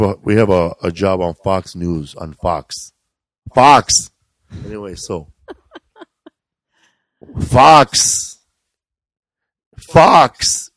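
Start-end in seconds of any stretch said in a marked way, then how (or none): 3.5-4.1 loud mock shout of Fox; shouted with a high pitch. Shouting the network name. 7.4-10.7 loud mock shout of Fox; shouted with a high pitch. Shouting the network name.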